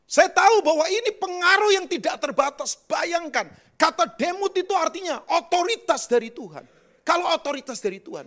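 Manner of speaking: high, loud, emphatic sermon voice; forceful preaching. Real, not acted. Preacher calling the congregation to be an image, forceful. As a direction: proclaim it to the whole congregation.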